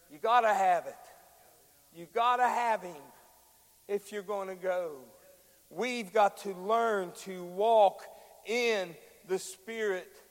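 usual speaking voice

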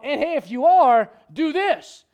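the devil baiting Jesus, loud and mocking; taunting devil. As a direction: push him to prove it. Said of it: "The devil. He goes".

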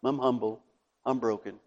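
lightly mocking a mopey humble person, dropped voice; mock gloomy humble. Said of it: "like a prune face".

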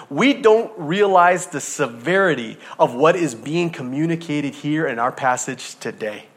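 normal talking voice

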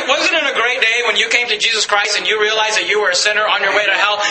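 ordinary talk

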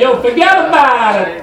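slow, high, loud New York Italian tough-guy voice; playful Italian New York accent jab at the congregation; mock Italian New York accent. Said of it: "I want Italian spaghetti".

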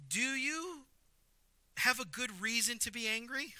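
put-on gentle parent-to-child voice; reasoning parent. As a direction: ask it like a parent coaxing an upset kid. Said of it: "like a parent comes down, you know, to the level of their kid".

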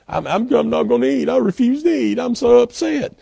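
high whiny pouting king; mock-crybaby king refusing to eat; whiny big baby. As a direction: whimper like a sulking child. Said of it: "starts crying like a big baby".

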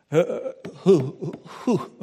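halting, repeating stammer on who. Retold or imitated. Preacher voicing Moses stammering before God, mock stammer. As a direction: stumble over each who as the words won't come. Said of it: "he stammers".

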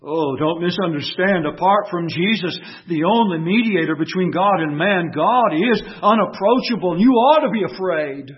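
fervent preacher's call; full-force preaching. Acted. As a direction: thunder it from the pulpit.

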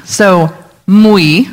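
muy said slowly, M then we. Shown as a demonstration. Pastor teaching the church the Ukrainian word muy. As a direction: say muy as "mwee", starting on a clear M. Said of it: "say it with an M and we".

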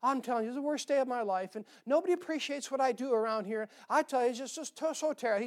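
hammed-up bad-day moan, rising as it goes; mock complaining. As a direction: play up the woe-is-me griping. Acting out complaining to everybody.

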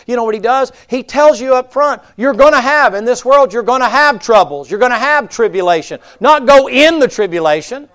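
loud, high preaching build; rising sermon shout; preaching climax. Real, not acted. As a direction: belt it out from the pulpit. Preacher builds to full-force climax.

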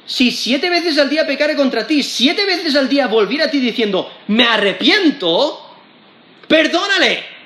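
scripture reading. Acted. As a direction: lift the verse up as you read it to the congregation. Reads the Bible verse aloud.

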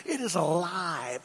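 slow, drawn-out "alive"; emphatic declaration. Real, not acted. Preacher says church is an organism, slow and emphatic. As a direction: stretch it out with conviction.

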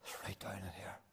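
breathy whisper, very soft. Acted. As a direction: lean in and whisper it. Intimate point about the heart.

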